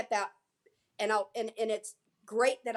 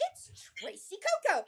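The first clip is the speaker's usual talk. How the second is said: excited reveal; 8 semitones higher than usual. Retold or imitated. Marina reveals her to crowd.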